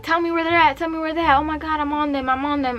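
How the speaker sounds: high, insistent retold phone call; urgent demand. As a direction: act out his adrenaline in the chase. Acted urgent call during car chase.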